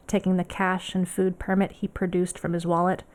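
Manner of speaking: ordinary delivery, nothing marked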